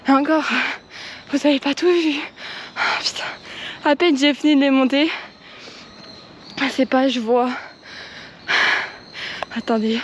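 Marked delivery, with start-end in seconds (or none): 0.1-7.5 puffing after the stairs; winded and panting; out of breath. Real, not acted. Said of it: "comment je suis essoufflée".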